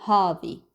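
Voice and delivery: "هذه" as a heavy "HAW-dhi"; heavier first syllable. Shown as a demonstration. Teacher demonstrating the Gulf form, heavy first syllable. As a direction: darken the first vowel of "هذه", no final "hi". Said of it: "make the first syllable heavier".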